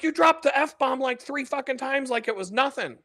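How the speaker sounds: imitated high-pitched telling-off; scolding girlfriend. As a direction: act out a girlfriend's complaint, voice way up. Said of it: "But that's how she's telling me that".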